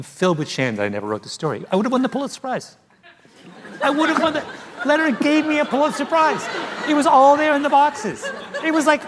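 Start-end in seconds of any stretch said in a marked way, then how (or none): 3.8-4.4 higher, laughing through the words. Real, not acted. Repeats it, breaking into laughter. 4.9-9.0 higher, laughing through the words. Real, not acted. Laughing about the missed Pulitzer.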